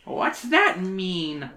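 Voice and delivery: slow, grim question. Acted. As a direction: draw the question out grimly. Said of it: "Mary asked grimly".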